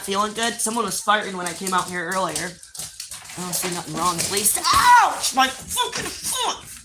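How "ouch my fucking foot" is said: sharp cry of pain, then a drawn-out curse; sudden hurt shout; pained yelp. Real, not acted. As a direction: yelp at a stubbed toe and drag out the curse. Stubbed foot, pained outcry.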